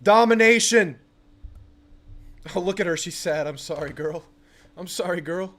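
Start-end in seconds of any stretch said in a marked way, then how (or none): 0.0-1.0 high, loud hype; excited celebration. Real, not acted. Celebrating the sack. 3.5-5.5 quiet, tender soothing; soft apology. Real, not acted. Apologising to the dog.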